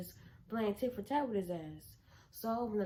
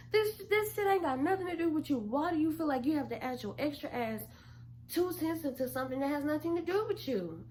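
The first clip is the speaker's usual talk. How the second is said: exasperated rant; 6 semitones higher than usual. Retold or imitated. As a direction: tell him off. Mocking brother's tone.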